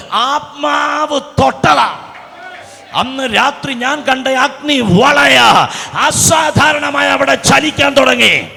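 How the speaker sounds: high, loud, slow shouted preaching; fiery sermon climax, drawn out and shouted; preaching at full force. Acted. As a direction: thunder it out from the pulpit.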